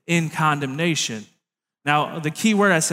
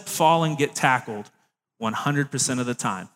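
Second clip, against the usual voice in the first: usual speaking voice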